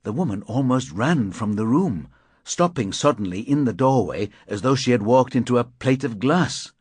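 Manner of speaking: usual speaking voice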